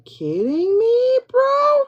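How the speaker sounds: bro squeaked very high and loud; can't believe what she sees; amazed disbelief. Real, not acted. Amazed by the reading.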